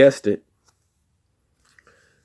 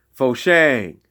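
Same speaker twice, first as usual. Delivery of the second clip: high, louder call; 4 semitones higher, 4 dB louder than usual. Acted. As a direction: call it out to the group. Call and response again.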